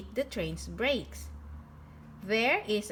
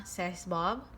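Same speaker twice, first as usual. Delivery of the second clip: usual speaking voice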